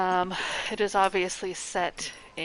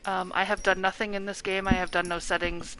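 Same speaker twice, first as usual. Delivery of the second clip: usual speaking voice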